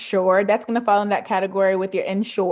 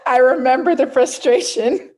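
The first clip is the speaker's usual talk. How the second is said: words bubbling with laughter, a bit louder; laughing through words; 4 semitones higher, 7 dB louder than usual. Real, not acted. Trying to resume her story while still laughing. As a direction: keep laughing as you talk.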